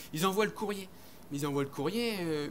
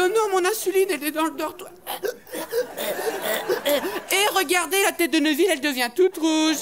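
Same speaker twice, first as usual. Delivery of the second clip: high, loud, squealing alarm; higher, louder mock panic; panicked boy; 9 semitones higher, 7 dB louder than usual. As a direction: shriek like a clumsy kid in trouble. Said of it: "Il dit".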